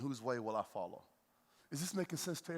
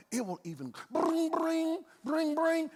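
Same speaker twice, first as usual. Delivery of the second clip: high sing-song bring, bring; phone ringing imitation; 13 semitones higher, 4 dB louder, slower than usual. Pastor mimics the calendar reminder, high and ringing. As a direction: ring high like a phone alert.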